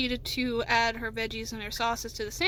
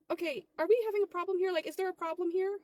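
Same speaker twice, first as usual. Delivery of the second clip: high, quick, indignant questioning; confrontational challenge; 6 semitones higher than usual. Acted. Manager challenges arguing worker, indignant. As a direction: challenge them with pitch raised.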